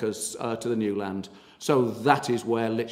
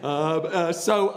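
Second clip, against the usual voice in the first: laughing through the words; 5 semitones higher, much slower than usual. Real, not acted. Tour guide laughs at being called Willy Wonka. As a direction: chuckle at the memory while speaking. Laughing at his own story.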